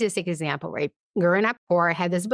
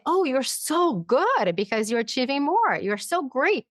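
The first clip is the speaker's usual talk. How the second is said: higher-pitched mock gushing praise; 6 semitones higher than usual. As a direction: heap on the praise, sweetly overdone. Acted praise rewarding the achiever.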